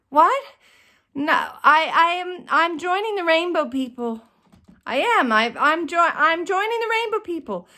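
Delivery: stubbornly repeating through the daze; dazed insisting. Retold or imitated. Concussed on the floor, telling helpers she is joining, dazed and insistent.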